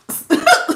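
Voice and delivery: quick high burst of laughter. Real, not acted. Reader amused at her own urge, bursting into a high laugh. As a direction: let a laugh pop out high.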